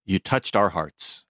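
usual speaking voice

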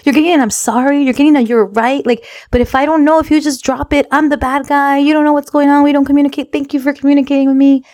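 high-pitched, encouraging mom voice; retold pep talk, pitched well up throughout; gassing her up. Mom praising daughter for communicating, high acted encouragement.

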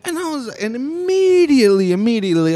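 a real outraged outburst; outraged burst. Real, not acted. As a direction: blurt it out, shocked and angry. Outraged at the remark.

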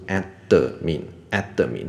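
“admin”错念成ad-de-min; 在“admin”的d后多塞一个“的”; 三个音节. Shown as a demonstration. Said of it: "admin就三个音节了". (分三拍念“admin”，d带母音)

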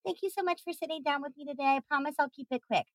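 soft, fast, timid salesperson; overly apologetic and meek; meek passive voice. Retold or imitated. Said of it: "they swing way wide into the".

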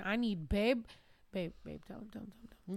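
hushed urgent whisper; barely voiced, pleading under breath. Whispering to partner in retold scene.

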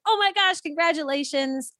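high, gushing congratulations; imitated high-pitched squeal of congratulations; excited congratulations. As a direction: gush like a delighted friend. Acted reaction of everybody.

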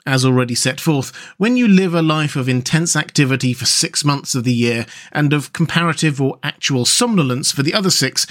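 usual speaking voice

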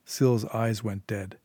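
ordinary delivery, nothing marked

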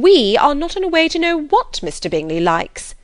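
high, loud huffy complaint; wounded mother's sharp protest; resentful. A narrator's reading. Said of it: "said her mother resentfully".

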